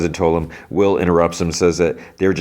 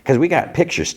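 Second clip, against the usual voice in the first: higher-pitched eager interjection; blurting out; 10 semitones higher than usual. Retold or imitated. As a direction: blurt it in over the others. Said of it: "Rich blurts in".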